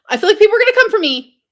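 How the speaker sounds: high, loud and fast, half laughing in dread; loud, fast blurt. Real, not acted. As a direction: get it out before nerve fails. Anticipating backlash, blurts it out.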